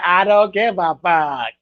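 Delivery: slow, drawn-out mimicry; mocking imitation. Host imitating him for chat, slow mocking drawl. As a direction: stretch every word, making fun of him. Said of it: "Hey, he said".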